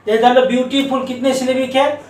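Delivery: ordinary delivery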